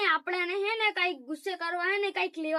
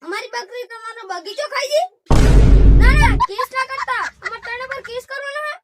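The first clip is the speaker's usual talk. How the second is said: higher-pitched, agitated talk into the phone; alarmed phone call; 6 semitones higher, 8 dB louder than usual. Pretend. On the phone about a goat eating a garden, alarmed. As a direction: plead and argue down the line in a high voice.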